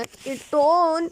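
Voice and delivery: Ketone stretched very long on its vowels; drawn-out key term. Real, not acted. Teacher telling students to remember a point, drawn-out emphasis. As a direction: draw out ketone slowly for emphasis.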